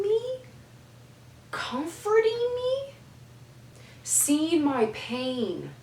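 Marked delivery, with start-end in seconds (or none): 0.0-2.9 high, slow disbelieving question; much higher, drawn-out amazement; incredulous. Real, not acted. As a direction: stretch it out in wonder. Amazed daughter consoled her.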